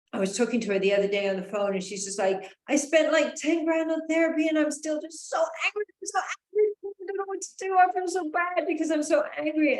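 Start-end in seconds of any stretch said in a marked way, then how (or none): 2.7-9.8 much higher, loud and upset; distressed friend. Retold or imitated. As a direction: imitate a friend overwhelmed by her anger. Friend venting on the phone.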